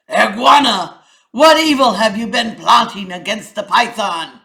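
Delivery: loud, ringing king's proclamation; lion king. Acted. King addresses the council, loud and commanding. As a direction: announce the chain of blame.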